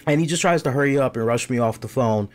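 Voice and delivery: usual speaking voice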